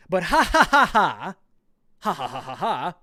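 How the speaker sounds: hammed-up mocking laughter; mock gloating laugh. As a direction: cackle it out as a gloating troll. Reading a gloating quoted tweet.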